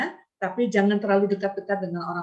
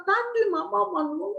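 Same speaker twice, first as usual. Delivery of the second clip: put-on higher, whimpering child voice; a touch of a child's crying complaint; 11 semitones higher than usual. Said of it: "Pokoknya dia nangis".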